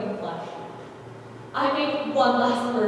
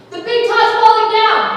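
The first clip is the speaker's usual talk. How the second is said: alarmed shout, much higher and louder; screamed; 9 semitones higher, 13 dB louder than usual. A narrator's reading. Man in the burning tent, alarmed shout. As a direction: warn everyone in terror. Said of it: "a man screamed".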